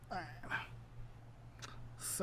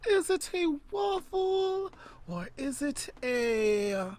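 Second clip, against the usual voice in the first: slow, stretched, higher question; drawn-out suspense; 7 semitones higher, 4 dB louder, much slower than usual. Acted. Teasing her partner with a dramatic pause, slow and drawn-out. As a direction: stretch every word to build suspense.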